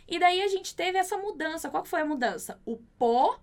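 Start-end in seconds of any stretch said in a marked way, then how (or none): none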